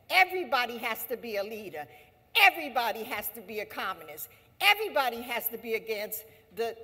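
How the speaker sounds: emphatic rallying refrain; rallying list. Real, not acted. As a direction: hammer every line. Rallying chant of demands.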